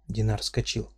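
plain reading voice

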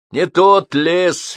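slow, weighty verdict. A narrator's reading. Neighbour pronouncing judgment on the forest, slow and weighty. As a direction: say it slowly like a final word. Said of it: "веско сказал сосед".

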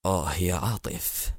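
low, soft, slow grieving murmur; overwhelming grief. Acted. President alone, murmuring his dead brother's name in grief. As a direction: breathe the brother's name with deep sorrow. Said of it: "مغمغما بحزن غامر".

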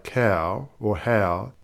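"cow" and "how" drawn out slowly on the W; heavy final W. Shown as a demonstration. Presenter showing Australian speech, heavy W ending COW and HOW. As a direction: lean on the end of "cow" and "how". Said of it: "place a heavy emphasis on the final consonant".

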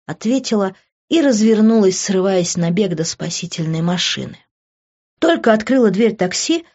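ordinary delivery

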